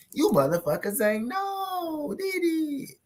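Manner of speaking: high stretched teasing squeal; cartoon high taunt; mock squeal. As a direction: squeal it out to tease the viewers. Hyping fight day to viewers.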